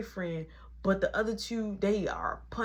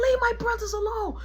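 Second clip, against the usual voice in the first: high, raised shout; retold protective yell; 13 semitones higher than usual. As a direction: shout them off your brothers. Caleb defending his brothers.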